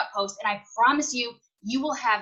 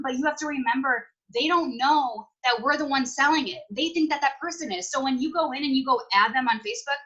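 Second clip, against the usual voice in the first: ordinary talk, nothing marked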